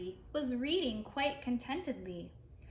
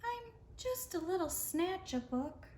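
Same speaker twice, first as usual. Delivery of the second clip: higher, softer mumble, a touch of sorrow; mournful mumble; 8 semitones higher, 3 dB quieter than usual. Acted. The Snatch-a-Book, head hung, mumbling to Eliza in a higher mournful voice. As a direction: say it small and ashamed. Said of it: "He mumbled with a mournful look".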